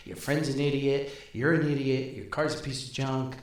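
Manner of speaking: usual speaking voice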